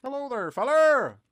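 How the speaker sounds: high, slow drawn-out hillbilly greeting; silly hillbilly call. Pretend. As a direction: call out like a goofy old prospector. Calling out in silly voice.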